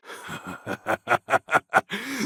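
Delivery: high rising triumphant laugh; a booming warlord laugh; gloating laugh. Acted. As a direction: laugh in someone's face.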